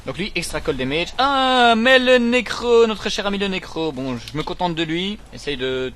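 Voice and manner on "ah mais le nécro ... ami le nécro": loud, high, drawn-out welcome easing down; theatrical fond recognition; mock delighted greeting. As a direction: greet the enemy like a dear old friend. Mock greeting to familiar enemy.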